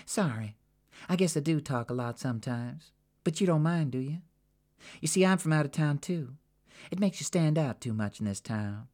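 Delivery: breezy, coaxing talk; Jackie, chatty. Acted. Jackie to Sabina, chatty and friendly.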